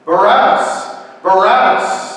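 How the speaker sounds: high, loud chanted name; crowd shouting. A narrator's reading. Crowd demands a prisoner from Pilate, shouting. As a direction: shout the name with a rising end. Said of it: "the crowd shouted out".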